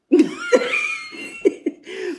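high squealing laugh; high burst of laughter. Real, not acted. Laughs instead of answering.

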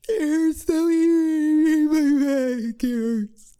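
put-on loud, high, drawn-out childish bawling; hammed-up tearful wail; pained wailing. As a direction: bawl the words until they blur. Acted patient wailing in pain.

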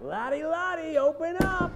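calling out. Acted. Calling through the door.

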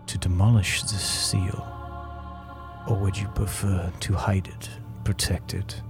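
tiny low breathy voice inside your head; twinkling breathy fairy whisper. Acted. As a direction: softly voice a mysterious tiny spirit, fading to a whisper. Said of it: "you hear a tiny voice in your head".

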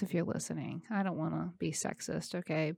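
dropped low and soft, an aside; quiet aside. Real, not acted. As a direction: tuck it in softly to the fellas. Aside to male listeners.

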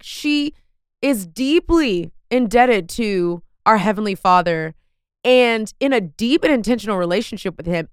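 ordinary talk, nothing marked

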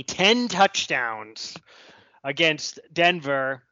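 ten touchdowns spaced out word by word; slow and emphatic. Real, not acted. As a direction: lean on each word slowly. Stressing the lopsided score.

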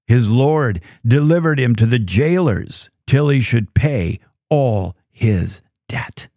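normal talking voice

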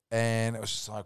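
normal talking voice

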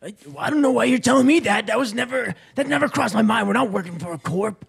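indignant. Acted. Indignant reply to partner.

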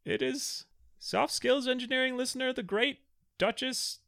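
high, slow herald proclamation; court herald. Pretend. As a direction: proclaim it like a town crier. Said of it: "Get a court herald".

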